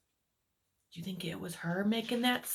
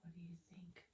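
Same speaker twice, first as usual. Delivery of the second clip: whisper; 5 semitones lower, 26 dB quieter, much faster than usual. Real, not acted. Suspense whisper to child listeners.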